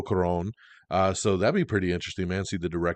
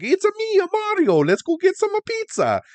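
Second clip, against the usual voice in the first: hammed-up Italian accent, much higher and louder; mock Italian Mario; 6 dB louder than usual. Mocking the Mario voice for viewers, hammed-up Italian accent. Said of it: "him being like".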